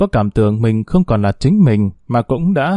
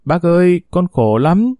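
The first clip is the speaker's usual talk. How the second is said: mock wailing; 6 semitones higher than usual. A narrator's reading. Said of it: "cô ta khóc nức nở".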